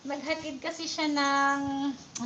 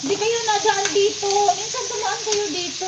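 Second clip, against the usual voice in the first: calling out; 5 semitones higher, 7 dB louder than usual. Real, not acted. Calling out to passing neighbors.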